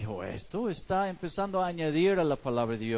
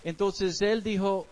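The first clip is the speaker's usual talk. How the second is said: ordinary delivery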